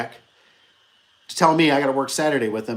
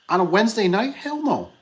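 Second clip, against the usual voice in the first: incredulous refusal; 7 semitones higher than usual. Real, not acted. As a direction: pitch jumps way up in disbelief. Boss refusing truck request.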